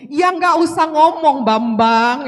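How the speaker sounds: loud, drawn-out exasperated yell; mock angry outburst. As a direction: re-enact blowing up at someone, slow and loud. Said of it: "Saya sampai gebrak meja loh, se-, kalau waktu itu". (menirukan bentakan marah yang keras)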